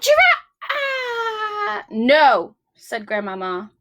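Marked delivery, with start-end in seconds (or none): 0.0-2.5 put-on high, slow, wailing old-lady cry rising into a loud no; hammed-up grandma moaning her refusal; old grandmother. Grandmother turning down the giraffe plea, high wail then loud no.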